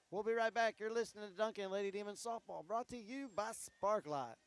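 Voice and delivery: high, loud broadcast sign-off; radio announcer. Acted. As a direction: sell the sponsor break. Station break sponsor read.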